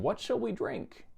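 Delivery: high, slow plaintive question of the thirsty crowd; complaining people. Acted. Grumbling Israelites quoted in scripture.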